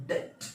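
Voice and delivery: debt as "det", silent b; correct pronunciation. Shown as a demonstration. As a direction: model debt without any b. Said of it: "should be pronounced as". (debt का उच्चारण "डेट", "ब" मौन)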